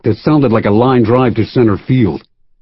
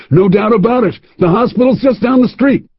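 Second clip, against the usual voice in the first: put-on high, gleeful old man; delighted old man; 10 semitones higher than usual. Old man at the bar inspecting the nose, high gleeful. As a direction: relish the bad news, pitched up. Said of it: "his voice high with delight".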